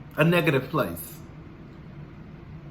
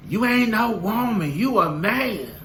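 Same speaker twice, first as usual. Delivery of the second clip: mock commenter voice; 4 semitones higher than usual. YouTuber mocking a hater's comment, in a mock gruff voice.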